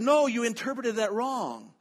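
retold protest, high and loud; emphatic correction. Teacher correcting his interpreter, in a high, loud retold objection.

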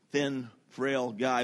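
ordinary talk

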